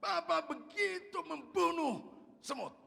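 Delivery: high, loud cry of protest at papa; slow, drawn-out accusing shout; child's shouted accusation. Retold or imitated. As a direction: yell it out like an outraged child. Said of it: "Teriak dia".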